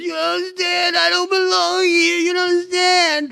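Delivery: very high, stretched-out wail; hammed-up high-pitched pleading cry, words dragged out; panicked new prisoner impression. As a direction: imitate a movie convict wailing in protest, slow and high. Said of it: "one scene where the heavy set guy walks into the, the prison".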